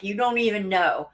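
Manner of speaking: usual speaking voice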